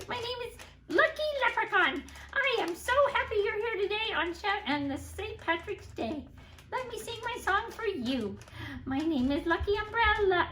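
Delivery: high, quick puppet voice; Lucky Leprechaun puppet. Pretend. Leprechaun puppet greeting children, high and quick. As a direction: chirp it brightly.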